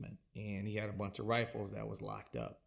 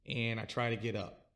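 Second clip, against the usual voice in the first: usual speaking voice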